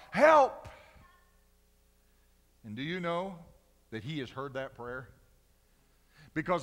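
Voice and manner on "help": loud, high plea for help; pleading cry. Retold or imitated. Preacher repeating his prayer to God as a loud plea. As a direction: cry it from the heart. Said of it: "One word with a plea and a cry from the heart".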